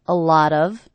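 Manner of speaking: "lot of" with the T as a quick D; flap T. Shown as a demonstration. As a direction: say "A lot of" with a flapped T. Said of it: "this T is a flap T".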